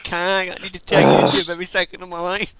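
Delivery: loud, high whiny parody; mocking whiny friend. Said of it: "bleep out each time you say, like".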